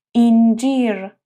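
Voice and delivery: اینجیر with a long spread ii; Turkish word incir. Shown as a demonstration. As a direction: say اینجیر with the mouth open wide. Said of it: "با دهان کشیده و باز". (اینجیر با دهان کشیده)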